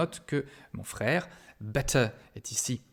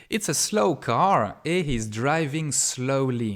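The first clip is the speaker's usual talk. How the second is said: slow example reading; 2 semitones higher, 4 dB louder, slower than usual. Shown as a demonstration. Teacher reads example sentences slowly to students. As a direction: read It's a slow car et he's driving slowly slowly and clearly. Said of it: "Regardez ces deux phrases".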